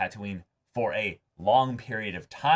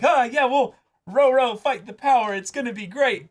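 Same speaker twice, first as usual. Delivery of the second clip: much higher, louder hammed-up cheer; mock rally cry; 14 semitones higher, 7 dB louder than usual. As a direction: squeal it like a naive rebel rallying the troops. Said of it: "you don't just go like".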